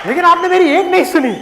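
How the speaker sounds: high, loud mock outrage; hammed-up exasperated complaint; accusing daughter. Acted daughter blames her father.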